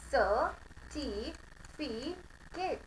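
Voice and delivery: सर्टिफिकेट said very slowly, r dropped; सर्टिफिकेट drawn out with no r sound; demo pronunciation. Shown as a demonstration. Teacher to class, सर्टिफिकेट said very slowly with the r dropped. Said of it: "तो pronunciation".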